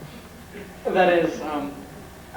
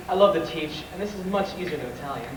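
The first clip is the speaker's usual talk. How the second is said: normal talking voice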